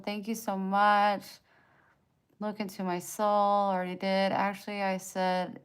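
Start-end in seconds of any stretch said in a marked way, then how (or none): none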